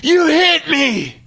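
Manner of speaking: high, slow, shrieked accusation; son's scream. Retold or imitated. Son on the grass screams at dad, high shriek. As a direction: shriek it like a hurt kid blaming dad. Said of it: "and Kaysen screams".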